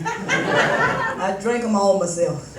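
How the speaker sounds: slow, chuckling admission; sly laughing confession. Acted. Comic to the audience about drinking, slow laughing confession. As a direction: let the laugh leak through a slow confession.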